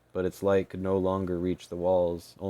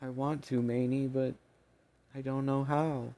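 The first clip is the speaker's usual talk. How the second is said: defeated fairy voice; 7 semitones higher than usual. Acted. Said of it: "grabbed her arm in defeat".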